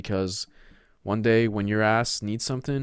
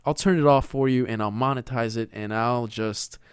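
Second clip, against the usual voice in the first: ordinary talk, nothing marked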